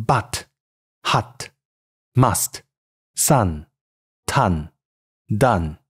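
British English but, hut, must, sun, ton, done, vowel further back and open; 英式英语读音. Shown as a demonstration. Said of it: "英式英语". (用英式英语逐词示范)

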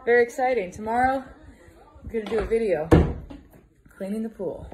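loud projected reply; calling out. Real, not acted. Vlogger answering distant Carlos about video plans, calling out. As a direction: shout the news across to a neighbor.

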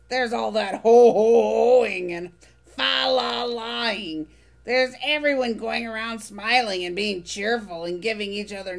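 gleefully sour, savor every complaint; Oscar grouch. Acted. Oscar voiced, grumpy.

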